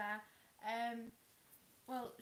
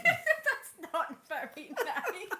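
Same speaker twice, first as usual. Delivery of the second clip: slow, loud words through laughter; laughing protest; 8 semitones higher, 14 dB louder, much slower than usual. Real, not acted. Co-host objects to a teasing joke, laughing. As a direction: protest while cracking up, high and drawn out.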